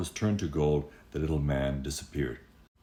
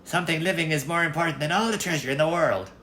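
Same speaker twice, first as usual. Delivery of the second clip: much higher, quick imp voice; impish little man; 12 semitones higher, 3 dB louder, faster than usual. Acted. Little man refuses the treasure, high and quick. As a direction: rattle it off like a goblin. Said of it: "said the little man".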